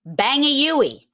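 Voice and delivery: slow, loud "Bang a Yui"; Boston "Bang a Yui". Shown as a demonstration. Teacher announcing a local phrase, slow and loud. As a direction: draw out "Bang a Yui" broadly. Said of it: "Bang a Yui is to make a U-turn".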